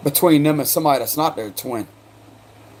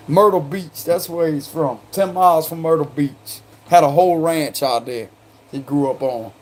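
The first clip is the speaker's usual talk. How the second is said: normal talking voice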